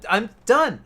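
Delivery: ordinary delivery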